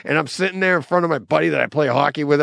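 ordinary delivery

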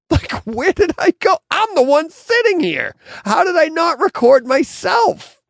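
very high-pitched incredulous yelling; squealing disbelief at your own blunder; exasperated outburst. Retold or imitated. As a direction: replay your frustration, loud and sky-high. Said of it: "I was so frustrated".

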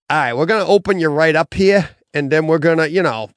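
put-on joking doctor in a broad Boston accent. Doctor explaining surgery to a patient, mock Boston accent. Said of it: "Talking a Boston accent".

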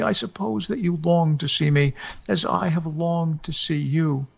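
high mournful wailing voice, partly whispered; ghostly wail. Acted. Dead wife wails to husband at the door about the cold. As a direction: lament the cold as a restless spirit. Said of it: "or rather wailed".

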